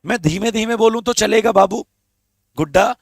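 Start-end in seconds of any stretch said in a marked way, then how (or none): none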